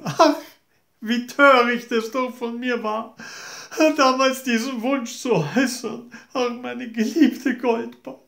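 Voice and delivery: hammed-up fake longing; fake sorrow. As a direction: play the grieving mother falsely. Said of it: "Sie stellte sich ganz traurig".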